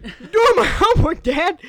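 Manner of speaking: high, loud, laughing whine; laughing son. Retold or imitated. Comic re-enacting the dazed son, laughing hard. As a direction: crack up mid-line.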